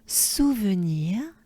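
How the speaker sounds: "souvenir" slowly in a French accent. Shown as a demonstration. Teacher modelling a familiar word, slow French accent. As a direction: draw out "souvenir" with French vowels and French r. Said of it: "apply it with a French accent".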